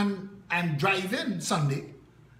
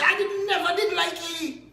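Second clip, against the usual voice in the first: high, louder mocking voice of a gossiping local; 11 semitones higher, 5 dB louder than usual. Mimicking people who swallowed the story wholesale, in a high mocking voice. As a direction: squeak it out like a smug busybody.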